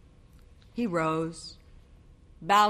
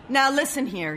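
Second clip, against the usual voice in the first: firm husband; 8 semitones higher, 4 dB louder than usual. A narrator's reading. Husband raising voice.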